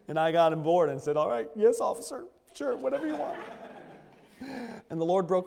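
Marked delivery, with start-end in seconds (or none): 1.2-3.4 high, laughing yes-sir compliance; mock obedience. Preacher mockingly giving in to God, high and laughing. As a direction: give in like a scolded recruit, half laughing.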